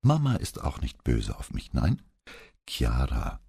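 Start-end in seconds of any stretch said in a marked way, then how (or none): 2.7-3.4 slightly unsure, tender address; etwas unsicher. A narrator's reading. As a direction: say the name with a touch of uncertainty. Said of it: "mit etwas unsicherer Stimme". (mit etwas unsicherer Stimme)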